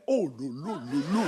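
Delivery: low, subdued oh-la-la of surprise; mock astonishment. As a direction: imitate an astonished woman, low and soft. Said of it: "quand elles sont étonnées, elles adorent faire". (étonnement feint, tout bas)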